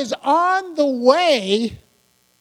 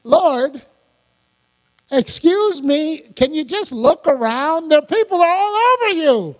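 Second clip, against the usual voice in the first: incredulous mock exasperation, pitch climbing to the end; hammed-up disbelief, higher as it builds; mock disgusted; 5 semitones higher than usual. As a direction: imitate a fed-up disciple pointing out the obvious. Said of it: "Peter was probably disgusted".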